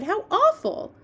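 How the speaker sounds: high-pitched, horrified exclamation; shocked cry. Acted. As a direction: cry out, appalled, as Sophie might. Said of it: "Sophie cried".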